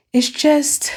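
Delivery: high, breathless gush of delight; gushing squeal. Real, not acted. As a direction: squeal it out, overwhelmed with joy. Gushing over regal representation.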